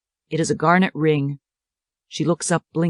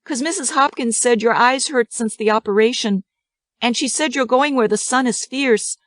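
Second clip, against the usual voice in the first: put-on much higher child voice; 7 semitones higher than usual. A narrator's reading. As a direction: explain like a child.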